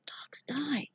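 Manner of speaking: breathy, frightened whisper. A narrator's reading. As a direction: whisper it in fear. Boy whispers his fear.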